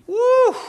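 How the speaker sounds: high, loud joyful whoop. Real, not acted. Pastor whooping to the congregation, delighted. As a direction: let out a delighted shout of praise.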